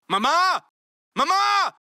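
high, loud calls for mother; calling out. Acted. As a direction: call across the house.

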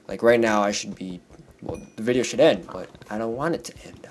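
ordinary talk, nothing marked

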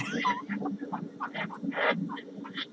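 breathy high giggle after the dare; laugh. Real, not acted. Character laughs at his own dare, giggling. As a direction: break into a giggle.

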